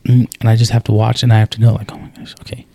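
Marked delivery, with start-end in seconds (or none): 1.9-2.6 breathy whisper, talking himself down. Retold or imitated. Dad reminding himself to let his daughter learn, whispered. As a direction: whisper it like a private self-reminder. Inner thought, forcing himself back.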